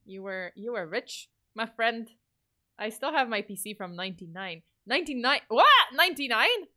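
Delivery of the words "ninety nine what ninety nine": surprised disbelief. Real, not acted. Reacting to a 1999 PC.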